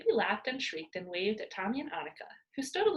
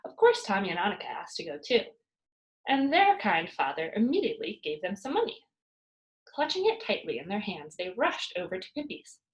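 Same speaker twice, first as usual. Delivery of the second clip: usual speaking voice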